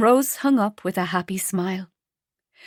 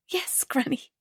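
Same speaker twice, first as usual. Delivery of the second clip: hushed, barely above a whisper; near whisper; 6 semitones higher, 7 dB quieter than usual. A narrator's reading. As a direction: say it softly, almost whispering. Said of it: "Rose said in a near whisper".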